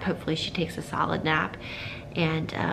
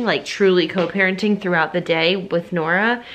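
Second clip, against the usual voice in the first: usual speaking voice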